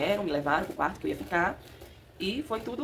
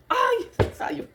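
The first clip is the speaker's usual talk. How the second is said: very high, louder burst of relief; relieved cry; 5 dB louder than usual. Retold or imitated. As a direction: gasp it out as she is born. The baby finally comes out.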